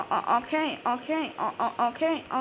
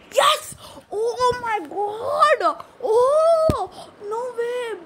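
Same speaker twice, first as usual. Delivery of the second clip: excited cheering; 8 semitones higher, much slower than usual. Real, not acted. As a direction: yell in triumph. Gamer wins a round.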